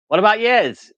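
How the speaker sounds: loud, high-pitched Irish lilt; Irish accent. Acted. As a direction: put on an amateur Irish accent. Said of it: "had to read it in Irish accent".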